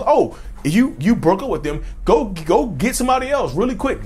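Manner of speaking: high, breezy mock friend urging you on; pushy friends. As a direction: toss off the advice like a careless friend. Friends' advice voiced.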